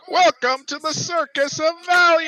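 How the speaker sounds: high, loud carnival barker shout; BioShock salesman voice. Retold or imitated. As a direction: bellow it like a vintage sideshow salesman. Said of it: "like, super".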